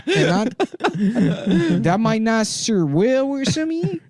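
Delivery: a hint of a Taiwanese accent. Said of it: "It's a traditional Taiwanese accent".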